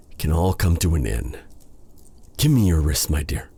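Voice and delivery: ordinary delivery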